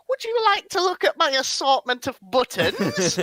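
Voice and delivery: high put-on old seller voice, teasing; old stall seller. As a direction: pitch it up like a doddery old trader offering buttons. Mocking old market sellers.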